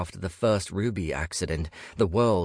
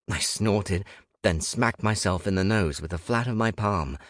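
ordinary delivery

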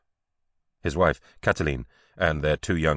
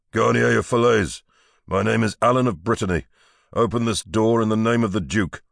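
loud, higher-pitched official summons; commanding call; 6 semitones higher, 4 dB louder than usual. Acted. Duke's man demanding entry at night, commanding call. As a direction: call it out through a shut door. Man calling through a closed door.

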